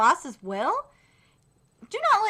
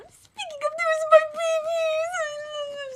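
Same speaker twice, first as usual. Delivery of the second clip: high doting coo; 12 semitones higher than usual. Real, not acted. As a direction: coo over your babies. Spotting favourite characters.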